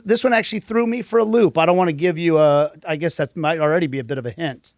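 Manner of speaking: normal talking voice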